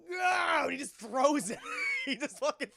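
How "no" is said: high loud shout. Retold or imitated. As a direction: yell it out. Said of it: "he goes".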